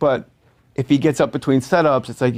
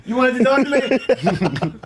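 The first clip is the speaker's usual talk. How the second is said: mock confused grip; 10 semitones higher, slower than usual. Imitating the grip.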